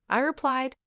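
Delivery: ordinary delivery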